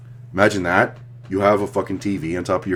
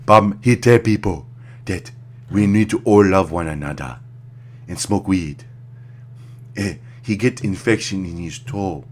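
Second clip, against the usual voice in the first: lightly put-on African accent, slow; a hint of an African accent telling a tale; mock African accent; 3 semitones higher than usual. Comedian telling [name]'s story, light African accent. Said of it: "I'll do an African one".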